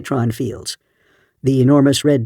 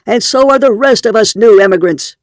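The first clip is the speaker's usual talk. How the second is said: shouting; 8 semitones higher, 6 dB louder than usual. A narrator's reading. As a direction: proclaim it from atop the car. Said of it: "he shouted".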